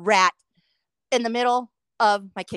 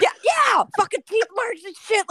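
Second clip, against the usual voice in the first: excited agreement; 10 semitones higher than usual. Real, not acted. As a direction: jump in eagerly, pitch shooting up. Excitedly confirms the listener's guess.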